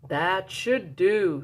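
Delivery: slow, content murmur; satisfied badger. A narrator's reading. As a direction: settle it slowly, pleased. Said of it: "he said".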